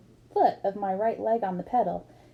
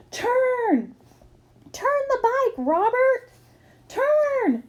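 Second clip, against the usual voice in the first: high, loud frantic yell; shouting warning; 4 dB louder, much slower than usual. A narrator's reading. Sibling shouting a warning at the runaway bike rider, frantic yell. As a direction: yell urgently for him to turn.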